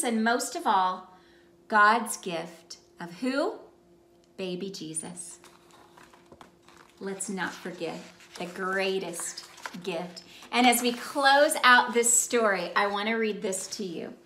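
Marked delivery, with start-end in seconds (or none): none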